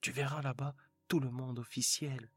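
eager husband pushing the invitation; husband's voice. Acted. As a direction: coax her like a proud, hopeful husband. Husband coaxing his wife.

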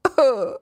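high, put-on boo-hoo sobbing; acted little-bear sobs. Tiny bear cries over his broken chair, high sobs. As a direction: sob like a tiny heartbroken bear.

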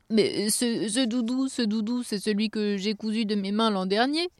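high, stammering elf voice; thin-voiced elf taken aback; dismayed little elf. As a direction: falter in disbelief like a small elf.

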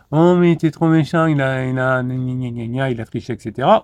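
sore loser's exaggerated complaint, trailing into nya-nya; mock whining. Losing boxer complaining about a cheating opponent, mock whining. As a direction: whine like a beaten boxer making excuses. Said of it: "Soit vous dites".

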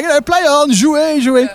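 high, airy wave-on; breezy referee. Retold or imitated. Referee tells protesting players to play on, high and breezy. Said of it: "I was like".

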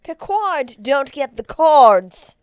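mock warning shout. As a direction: bellow it slowly like a playful threat. Joking warning to the magician.

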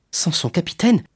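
rising, wondering echo; surprised question. Acted. Young sailor hears he may be captain, surprised question. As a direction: repeat it as a tentative question. Dantès echoes the offer, stunned.

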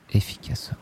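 efficacement breathed as a soft whisper; whispered echo. Shown as a demonstration. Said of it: "echo repetition".

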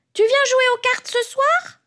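very high-pitched granny question. A narrator's reading. Mamie Laine invites Tonton Loup to cards, high granny voice. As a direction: pipe it up high as the grandmother. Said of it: "demande Mamie Laine".

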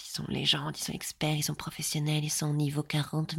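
whispered in mock reverence; sarcastic hushed awe at so-called experts; mock awed whisper. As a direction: whisper it like a sacred secret. Mocking coaches' claimed expertise.

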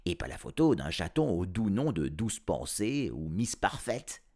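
plain reading voice

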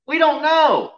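high, loud preacher's outburst; emphatic preaching. Real, not acted. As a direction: cry it out to the congregation. Preacher drives home his point.

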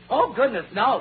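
ordinary talk, nothing marked

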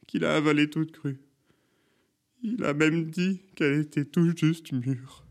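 high wailing witness account; old woman's tearful, drawn-out lament; on the verge of tears. A narrator's reading. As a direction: relive the horror, voice climbing. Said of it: "Elle était alors au bord des larmes".

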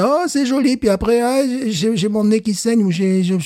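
high-pitched imitation of first graders; young pupils. Teacher imitating his first graders to his co-host, mock little-kid voice. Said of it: "les premiers grades".

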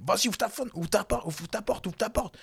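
high, urgent barked orders, over and over; rapid repeated yell. Retold or imitated. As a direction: hammer the command fast like an aggressive driver. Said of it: "Il dit deux, trois fois comme ça, hyper rapidement".

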